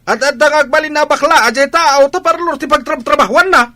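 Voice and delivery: high, loud furious scolding; angry scolding. Acted. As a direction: lash out at your wife in outrage.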